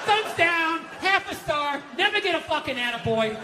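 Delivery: high-pitched mock bad review; disgruntled reviewer. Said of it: "nothing but bad reviews on the fucking husband".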